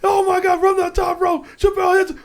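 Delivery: high, loud play-by-play call; excited shout. Real, not acted. Watching the clip, calling the big hit with an excited shout. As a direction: yell it like a ringside announcer.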